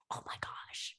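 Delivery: hushed, voiceless gasp of wonder; awed whisper. Retold or imitated. East Coast visitor seeing the park, awed whisper. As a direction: whisper it in mock awe.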